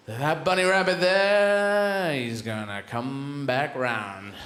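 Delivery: very slow, stretched-out words, falling low at the end; slow, deliberate scheming; explaining the plan. Acted. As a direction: draw it out like a schemer tracing the circle. Clever dog explains his plan.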